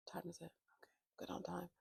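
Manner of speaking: very soft, half-whispered aside; hushed check-in with yourself; muttered aside. Real, not acted. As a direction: murmur to yourself, barely audible.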